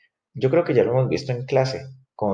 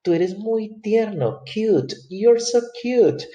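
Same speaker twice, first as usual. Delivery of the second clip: much higher, slow, drawn-out cooing; gushing girls; 11 semitones higher, slower than usual. Acted. Girls telling a cute guy he's adorable, high and gushing. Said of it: "las niñas ven allí el tipo tierno y le dicen".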